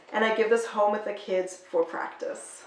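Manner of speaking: ordinary delivery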